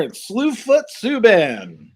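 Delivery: high, drawn-out announcer-style name call; mock announcer. Host spotting who's sitting there, mock announcer. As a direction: stretch the nickname out like an arena introduction.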